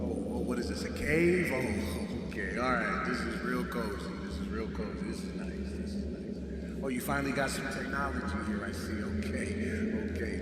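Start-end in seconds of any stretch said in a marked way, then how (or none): 0.0-8.7 confused muttering. Acted. Ex wakes up in a cave.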